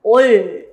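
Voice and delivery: ol said loud and clear in a set-apart voice; teacher models ol; syllable ol. Shown as a demonstration. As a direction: read ol out firmly as the class model. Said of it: "y leemos".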